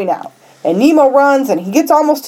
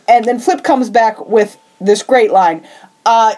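normal talking voice

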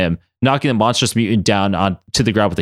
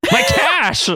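dramatic cry. Acted. Madeline reaching for her quarter, crying out dramatically. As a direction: overdo the loss of the coin. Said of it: "Madeline cried out dramatically".

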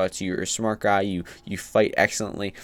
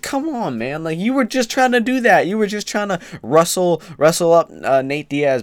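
high, strained come on man; loud, high exasperation; exasperated; 9 semitones higher, 5 dB louder than usual. Real, not acted. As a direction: push the voice way up in disbelief. Exasperated at Woodley's hypocrisy.

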